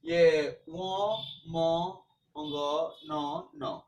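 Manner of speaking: व, म, अंग, न said slowly one by one through the nose; nasal letters demo. Shown as a demonstration. Teacher shows students where nasal letters sound, humming through the nose. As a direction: let each of म, अंग, न ring in the nose. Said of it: "इसका उच्चारण स्थान जो है नासिका है".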